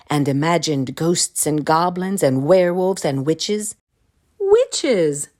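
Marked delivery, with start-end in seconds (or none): 4.4-5.3 high, louder story exclamation; spooky sudden outcry; dramatic exclamation. A narrator's reading. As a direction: burst out with the word. Froggy's frightened imagining dramatized.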